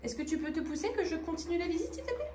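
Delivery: high, soft polite request; aparté, petite requête. Real, not acted. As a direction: ask softly, pitch climbing high at the end. Aparté pour demander le passage. (petite demande aiguë et douce, en aparté)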